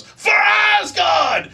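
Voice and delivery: very slow, high, loud shout; drawn-out royal war shout; kingly battle cry. Retold or imitated. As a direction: bellow it like a king leading his army. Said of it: "And Odin goes".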